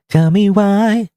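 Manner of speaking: slow high whine on "Tell me why"; drawn-out plea. Acted. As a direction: draw out "why" high and whiny, begging. Pleading in argument.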